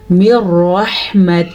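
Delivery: مر رحمتہ slowly, making ح and final ہ distinct; slow tajweed مر رحمتہ with long final "hee"; distinct ha sounds. Shown as a demonstration. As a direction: recite مر رحمتہ separating the two h sounds. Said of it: "ان کے فرق کو واضح کرنا سیکھیں".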